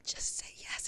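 voiceless mouthed plea; mouthed whisper. Retold or imitated. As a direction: mouth it silently across the room. Gesturing silently to Avery.

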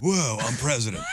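Keanu Reeves voice. Retold or imitated. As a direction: bellow it like Keanu winning. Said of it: "an example of his acceptance speech".

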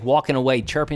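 ordinary talk, nothing marked

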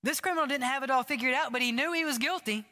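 high, fast, rising sermon climax; fervent preaching. Real, not acted. Pastor urging the church toward repentance, fervent preaching. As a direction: build it up with urgency and conviction.

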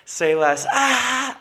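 catchphrase capped by a high fake laugh; douchey laugh. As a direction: say it high, then tack on a bro laugh. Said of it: "the douchey laugh was either before or after".

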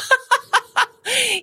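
high-pitched burst of laughter; real laugh. Real, not acted. Laughs at own joke about dog.

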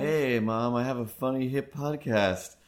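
a hammed-up son sending mom to bed; mock cool son. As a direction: play the hip podcaster bossing mom to bed, loud. Mocking the podcaster to his mom.

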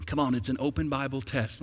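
normal talking voice

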